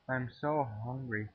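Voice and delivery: usual speaking voice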